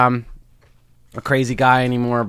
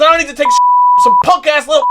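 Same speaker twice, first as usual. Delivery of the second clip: imitating a screaming, outraged old comic; high, loud mock meltdown; bugging out; 12 semitones higher, 6 dB louder than usual. Old comic explodes at young host, mock furious shouting. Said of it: "bugs out a thousand times more".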